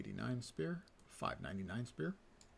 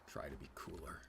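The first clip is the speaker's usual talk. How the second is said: unvoiced whisper, much softer; 8 semitones lower, 11 dB quieter than usual. Real, not acted. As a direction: whisper it like a secret. Whispered aside to kids.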